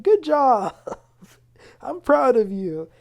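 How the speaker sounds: high, loud, gushing cheer; cheering friends. Retold or imitated. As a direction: celebrate her like proud friends. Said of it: "They were like".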